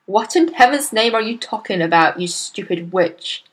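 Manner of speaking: usual speaking voice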